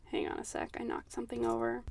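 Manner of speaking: hushed aside, nearly voiceless, rushed at the end; whispered aside. Real, not acted. Podcaster whispers an aside after knocking something over. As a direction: drop to a whisper to excuse herself.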